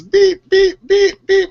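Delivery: high, loud phone beeps; sharp run of high-pitched notification beeps; imitated phone beeps. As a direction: mimic a phone that keeps going off.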